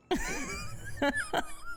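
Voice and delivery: squeaky high-pitched giggle. Real, not acted. Streamer laughing at a friend's cheeky reply, high giggle.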